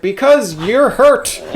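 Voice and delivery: high, slow, earnest voice; overly polite Canadian. Retold or imitated. Scorer answering the hurt goalie, slow, high and sweetly earnest. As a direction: draw it out sweetly, like it's obvious.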